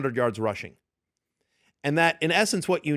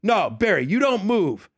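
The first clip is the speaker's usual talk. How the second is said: higher, emphatic command to stay put; mock yelled order; 6 semitones higher, slower than usual. Other guy countermanding Jay, mock yell. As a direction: imitate a man yelling an order. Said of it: "so now they're yelling back and forth".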